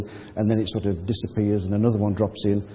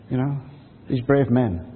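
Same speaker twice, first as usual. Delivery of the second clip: ordinary delivery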